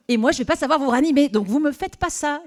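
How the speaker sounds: fast, high, loud mock panic; mock alarm. Temp lecturing her red-faced boss, alarmed. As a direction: rush it out in comic alarm. She scolds boss about heart attack.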